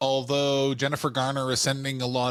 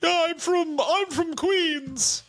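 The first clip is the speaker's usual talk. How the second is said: put-on New York accent; 10 semitones higher, slower than usual. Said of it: "Can you do a New York accent?".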